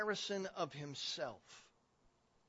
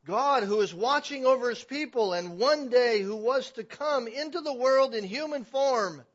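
loud, raised proclamation slowing at the end; forceful preaching; 7 semitones higher, 14 dB louder than usual. Real, not acted. Preacher proclaiming God come in human form, forceful preaching. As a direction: swell into a ringing declaration.

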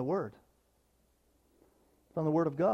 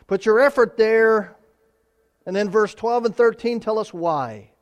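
high and loud, pressing the point home; preaching at full force; 5 semitones higher, 8 dB louder than usual. Acted. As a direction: proclaim it to the whole church.

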